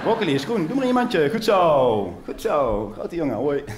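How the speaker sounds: high, cooing sing-song mum voice; mother praising her child. Retold or imitated. Mother praising her son for the broccoli, high and cooing. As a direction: lay the praise on thick. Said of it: "Zij deed dit".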